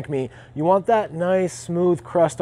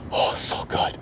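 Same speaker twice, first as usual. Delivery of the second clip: whispered delight; 6 dB quieter, slower than usual. Real, not acted. Pitmaster tasting by the fire.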